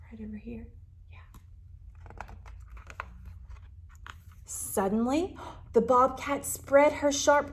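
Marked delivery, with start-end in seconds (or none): 0.1-2.2 put-on breathy stage whisper to the kids. Storyteller whispers to the children. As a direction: hush it like a secret.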